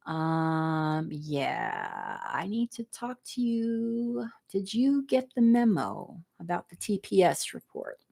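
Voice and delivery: imitating the droning Office Space boss. Talking to Peter about TPS reports, lightly mimicked boss drone.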